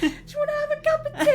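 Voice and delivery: very high, fast, laughing put-on voice; hammed-up Cockney kid; mock child. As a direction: rattle it off giggling. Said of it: "voiced child invite".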